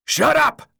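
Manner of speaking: loud, high-pitched angry roar; explosive command to be silent. A narrator's reading. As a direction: shout him down hard. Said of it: "Albie roared from behind".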